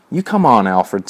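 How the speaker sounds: plain reading voice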